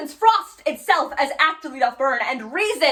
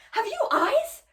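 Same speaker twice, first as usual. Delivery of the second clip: high, quicker question with less force, rising sharply; incredulous challenge; 10 semitones higher, slower than usual. Acted. Hamlet questions the widow, high incredulous reproach. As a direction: repeat it in pained disbelief. Hamlet repeats the acted accusation.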